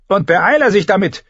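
high, loud hurrying order; impatient high-voiced command; demanding. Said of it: "verlangte er".

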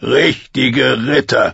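slow, sneering echo of her words; mocking. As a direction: throw her words back with scorn. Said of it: "spottete er". (höhnisch nachgeäfft)

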